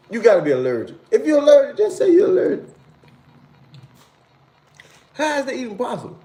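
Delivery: very high, loud repeated What?; high disbelieving what; incredulous outburst. Real, not acted. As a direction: shriek it in disbelief.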